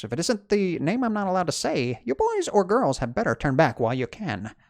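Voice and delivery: lightly voiced creepy caretaker; a hint of a menacing old caretaker.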